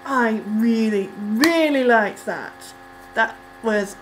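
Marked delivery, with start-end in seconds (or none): none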